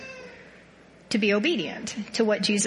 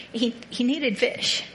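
normal talking voice

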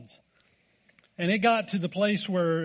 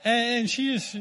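ordinary talk, nothing marked

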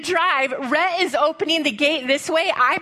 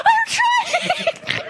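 laughing panicked yell. Retold or imitated. Mom shouts back to her son, laughing in panic.

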